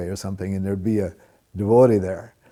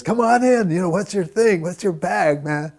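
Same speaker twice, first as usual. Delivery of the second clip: laid-back hippie; 10 semitones higher, 6 dB louder than usual. Retold or imitated. Roommate welcoming the Hare Krishna at the door, high and loud hippie voice. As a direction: ham up a mellow stoner roommate.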